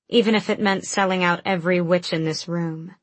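usual speaking voice

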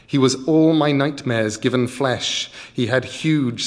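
usual speaking voice

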